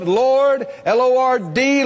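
L O R D spelled letter by letter; spelled out Lord. Shown as a demonstration. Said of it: "spelling Lord out".

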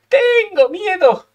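high, drawn-out wail of dread; hammed-up fear. Real, not acted. Gamer dreading the next turn, hammed-up fearful wail. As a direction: stretch the first word high, playing up the fear.

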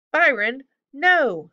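loud, high urgent yell. A narrator's reading. Simon ordering his griffin to stop, yelling. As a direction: shout it to stop an animal. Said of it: "Simon yelled".

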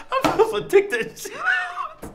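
high and laughing, scolding himself; laughing self-scolding. Real, not acted. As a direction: yell at yourself to stop laughing while giggling.

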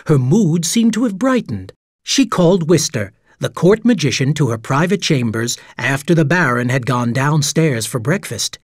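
ordinary delivery, nothing marked

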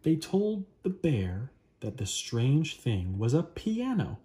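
usual speaking voice

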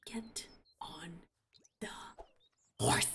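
whispered, no voice. Real, not acted. Telling her Sim to mount, in a whisper. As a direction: hiss the order under breath. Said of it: "whispered order".

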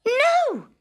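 high, loud no; sharp shout. A narrator's reading. Princess shouting no to the frog again. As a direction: slam the refusal.

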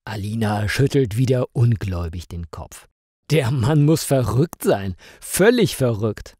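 usual speaking voice

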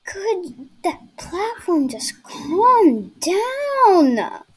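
frustrated. Real, not acted. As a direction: drag it out in annoyance. Frustrated at moving platform.

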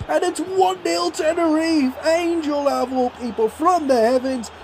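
high, rising play-by-play shout over the penalty; excited commentary call. Real, not acted. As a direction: call it like a live commentator losing it. Goal call, penalty given.

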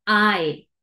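drawn-out "ay" gliding from a to i; long I sound. Shown as a demonstration. Teacher naming the long sound, stretched "ay". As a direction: stretch "ay" slowly, falling at the end. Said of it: "Sonido largo".

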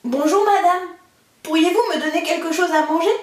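put-on high, bright little-boy voice begging politely; a lightly voiced boy asking for food. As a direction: ask the giantess for food like a timid boy. Boy Jacques begging at the door.

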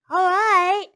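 high, loud piglet giving in; sighing piglet. A narrator's reading. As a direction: agree reluctantly as the piglet. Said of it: "sighed the piglet".

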